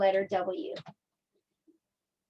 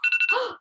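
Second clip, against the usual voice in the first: high mock-startled gasp; 13 semitones higher than usual. Teacher waking the pretend bear, startled gasp.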